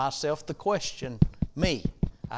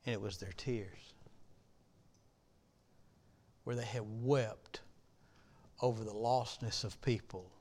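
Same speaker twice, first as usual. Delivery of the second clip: hushed; 3 semitones lower, 9 dB quieter than usual. Acted. Hushed memory of weeping ladies.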